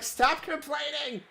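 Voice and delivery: a chat viewer telling the streamer off, mock scolding; imitating a chatter. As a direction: hand her a teasing scolding. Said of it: "One person's like".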